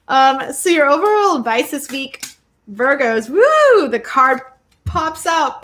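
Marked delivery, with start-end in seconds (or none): 3.3-3.9 loud, high "woo"; sudden delighted whoop; excited whoop. Real, not acted. Reader surprised as a card pops out, excited whoop.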